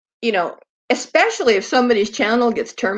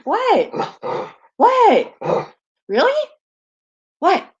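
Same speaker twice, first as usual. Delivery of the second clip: much higher squealy questions to the dog; excited high pet talk; 11 semitones higher, slower than usual. Real, not acted. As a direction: get the dog worked up.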